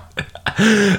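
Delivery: high, giddy burst of laughter; quick high giggle. Real, not acted. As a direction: laugh at your own joke. Laughs at own Pokemon joke.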